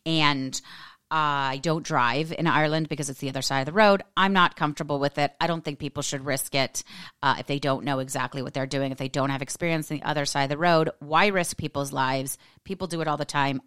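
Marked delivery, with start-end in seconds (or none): none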